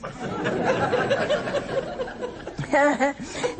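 high delighted giggle; a genuine high-pitched laugh; high real laugh. Real, not acted. Laughing at being a happy nun.

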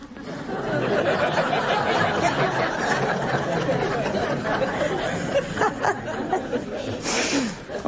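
warm delighted chuckle; real laugh. Real, not acted. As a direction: genuine amused chuckle. Caller asks if she's happy.

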